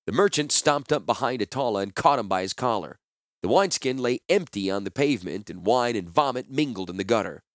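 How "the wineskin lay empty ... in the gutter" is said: ordinary delivery, nothing marked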